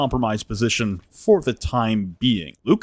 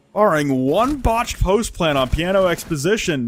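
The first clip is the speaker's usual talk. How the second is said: ordinary delivery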